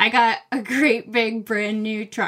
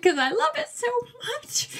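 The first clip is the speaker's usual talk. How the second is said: choked-up gush; 13 semitones higher, 3 dB quieter than usual. Real, not acted. Gushing about favourite book.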